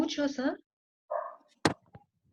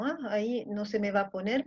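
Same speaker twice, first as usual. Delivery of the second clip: normal talking voice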